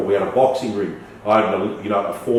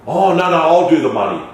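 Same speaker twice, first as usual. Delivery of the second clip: mimicking partner; 7 semitones higher, 6 dB louder than usual.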